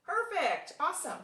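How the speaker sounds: high, loud cheer of praise; teacher cheering a correct answer, excited and high. Real, not acted.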